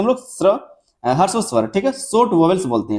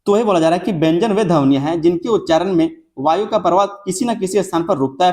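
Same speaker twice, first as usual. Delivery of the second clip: ordinary talk, nothing marked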